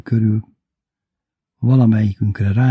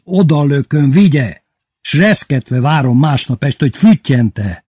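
louder, higher, rising passion; a smitten man pouring out his heart, louder; Bubu's confession; 3 semitones higher, 4 dB louder than usual. Acted. (egyre hangosabb, szenvedélyes vallomás)

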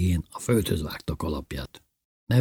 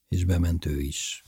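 ordinary delivery, nothing marked

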